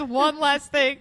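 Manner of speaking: mock-dramatic repeat of her words; mocking echo. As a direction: throw the line back at her, teasing. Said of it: "Famous last words".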